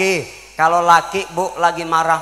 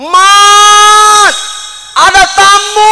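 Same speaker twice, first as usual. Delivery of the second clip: shrill high shout across the house; wife yelling; 12 semitones higher, 11 dB louder, much slower than usual. Retold or imitated. Wife yells to husband at coffee stall, high shouting. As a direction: holler the husband's name. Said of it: "bini teriak-teriakan".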